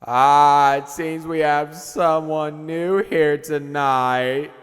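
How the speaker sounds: loud, slow, drawn-out stage announcement; frontman on the mic. Acted. Band's lead guy announcing a newcomer, loud showman drawl.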